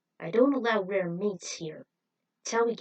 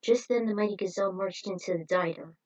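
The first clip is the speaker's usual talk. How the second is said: ordinary delivery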